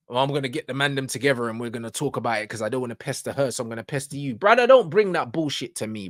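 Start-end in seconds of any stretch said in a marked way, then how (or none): 0.1-4.3 mocking quote. Mocking the clip guy's plan.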